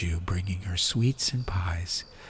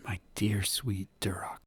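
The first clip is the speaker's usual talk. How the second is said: 1 tender; 8 dB quieter than usual. A narrator's reading. Fen tender to old friend.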